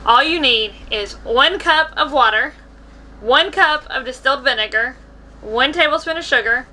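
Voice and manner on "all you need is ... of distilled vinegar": ordinary talk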